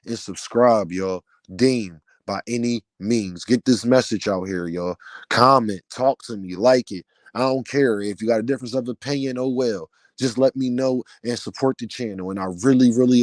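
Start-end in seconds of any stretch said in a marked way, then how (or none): none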